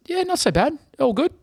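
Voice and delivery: squeaky imitation brushing off the damage; mocking the other driver. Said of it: "And he goes".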